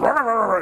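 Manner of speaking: a high, loud, barking rah rah from a dog; mock dog bark. Mimicking the neighbor's dog charging out at her, mock barking. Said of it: "And the dog goes".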